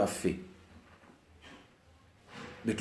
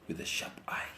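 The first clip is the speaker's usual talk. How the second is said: whispered; 14 dB quieter than usual. Shown as a demonstration.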